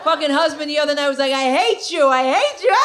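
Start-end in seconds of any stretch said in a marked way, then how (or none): 1.3-2.8 rising, higher mock wail; helpless mock protest. Husband to comedian wife who made him laugh, hammed-up protest.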